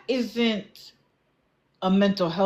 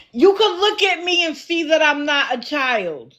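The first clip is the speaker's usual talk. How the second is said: higher-pitched, indignant protest, falling at the end; real exasperation; 6 semitones higher than usual. Real, not acted. Annoyed over an unreadable ID, exasperated. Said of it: "Getting on my effing nerves".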